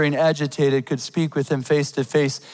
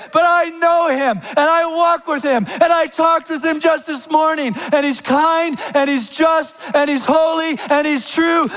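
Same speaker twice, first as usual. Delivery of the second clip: higher, ringing shout that defends a friend; loud defiant declaration; 10 semitones higher than usual. Pretend. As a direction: stand up and proclaim it boldly, slowing on the first and last lines. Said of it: "I'm gonna say in a loud voice".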